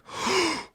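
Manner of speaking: sharp high mock gasp; a quick startled intake of breath; imitated gasp. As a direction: gasp in fake alarm. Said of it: "And her face was like".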